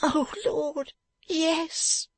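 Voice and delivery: high, long pained moan. A narrator's reading. Beaten man answering where it hurts, moaning. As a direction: moan it as if rocking with pain. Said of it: "moaned Fledgeby, rocking himself".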